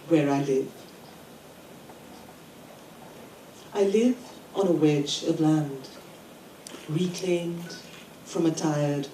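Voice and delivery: incantatory list, each phrase set apart; poem reading. Acted. Poet reading the title poem about the city to a conference, emphatic recital. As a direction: recite with emphatic openings and deliberate pauses.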